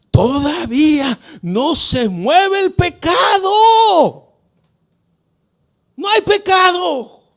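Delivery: high, loud, breaking proclamation; fervent preaching cry. Real, not acted. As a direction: cry it out slow and strong.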